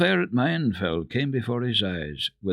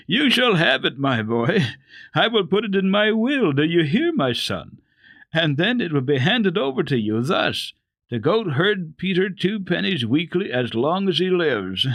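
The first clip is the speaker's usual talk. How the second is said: higher and louder, laughing through the words; an amused old lady speaking through her laughter; laughing grandmother; 9 semitones higher, 5 dB louder than usual. A narrator's reading. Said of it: "the grandmama kept on laughing".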